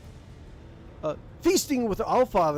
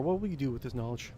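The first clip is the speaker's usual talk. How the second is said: ordinary talk, nothing marked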